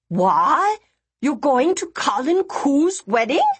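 high screech through a mouthful. Acted. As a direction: shriek it with food in mouth. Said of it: "she screeched, her mouth full of food".

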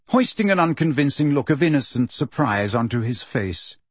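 ordinary delivery, nothing marked

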